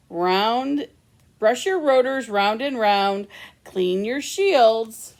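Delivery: sing-song bedtime read-aloud; cozy storytime voice; reading to children. A narrator's reading. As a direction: read it like a picture book to little ones.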